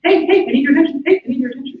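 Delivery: high, fast, insistent calling voice; high, quick mock nag; nagging subconscious. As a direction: call out for attention like a pestering inner voice. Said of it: "being like".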